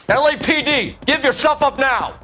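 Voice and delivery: high shouted police order; calling out. Acted. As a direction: call after the runner. Calling after fleeing suspect.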